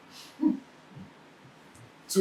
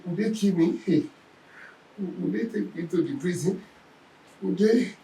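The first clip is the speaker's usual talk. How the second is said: higher, softer worried questions; mock fearful fretting; 5 semitones higher, 6 dB quieter than usual. Preacher imitating a palpitating heart's worries, mildly fearful. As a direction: act out a touch of panic, small and high. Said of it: "Don't let your heart begin to palpitate".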